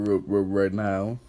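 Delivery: stuttering the r, slow; mock stutter. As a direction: imitate a stammer. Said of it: "comment how many times he stuttered".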